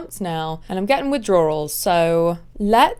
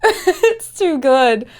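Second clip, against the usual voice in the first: laughing hard; 6 semitones higher, 4 dB louder, much slower than usual. Real, not acted. Reacting to video, laughing.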